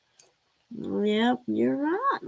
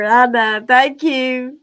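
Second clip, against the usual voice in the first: ordinary talk, nothing marked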